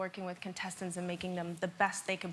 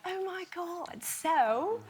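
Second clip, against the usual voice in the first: higher-pitched imitation English accent; put-on English accent; 6 semitones higher, 3 dB louder than usual. American judge demonstrating for the hosts, imitation English accent. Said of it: "but with an English accent".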